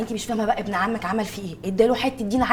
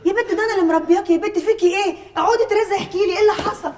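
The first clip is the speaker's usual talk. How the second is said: high, insistent scolding; exasperated prodding; 9 semitones higher, 3 dB louder than usual. Acted. Mistress pressing the crying girl who raised her, exasperated prodding. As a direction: demand the truth in a raised pitch.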